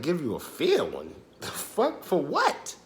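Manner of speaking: squeaky disbelieving question, pitch jumping high; can't believe he's asked for a fight; incredulous. Retold or imitated. Challenged to a fight, high incredulous disbelief. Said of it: "I'm like".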